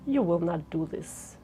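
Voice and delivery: soft, low and restrained; low volume. Shown as a demonstration. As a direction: drop it right down, quiet and low. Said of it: "let's change the volume".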